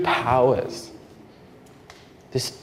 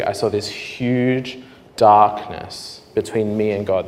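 ordinary talk

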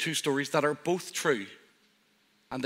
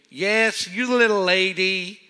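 loud, high hammed-up American drawl; American accent; 6 semitones higher, 8 dB louder, slower than usual. Preacher imitating an American fan in an American accent. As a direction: put on a big friendly American accent, badly. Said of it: "terrible with accents, but I'll try".

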